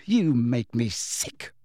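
slow, weighty disgust; slow right down with contempt. A narrator's reading. Lily finishing her tirade at James, disgusted.

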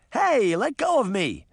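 put-on high protesting yell of a little girl; little sister yelling to be freed; indignant shout. A narrator's reading. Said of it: "Daphne shouted".

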